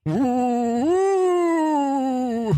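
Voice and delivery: high, loud wailing ghost call; a hammy haunted woo-woo; ghost noise. Shown as a demonstration. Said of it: "go through my entire repertoire".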